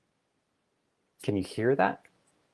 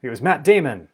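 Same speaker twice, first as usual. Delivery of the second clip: louder, higher, sure of itself; mind's confident answer; 6 semitones higher, 11 dB louder than usual. Retold or imitated. The mind answering the request, louder and higher. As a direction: blurt the guess like a know-it-all. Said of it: "He goes".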